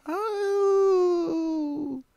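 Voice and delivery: very high howling cry of oh; coyote howl. Retold or imitated. Imitating coyotes howling in the distance, very high. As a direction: howl like a coyote far away. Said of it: "I'm hearing, like".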